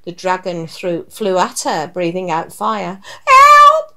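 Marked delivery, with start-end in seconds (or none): 3.3-4.0 very high, loud shriek; cry for help. A narrator's reading. Witch chased by a fire-breathing dragon, crying for help. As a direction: shriek for rescue as the storybook witch. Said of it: "cried the witch".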